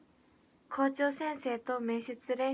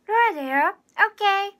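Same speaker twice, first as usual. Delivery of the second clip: loud, bubbly, high celebrity voice with a playful lilt; Rola impression; 7 semitones higher, 10 dB louder, faster than usual. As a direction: bounce it out like a cheerful TV idol. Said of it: "いきます".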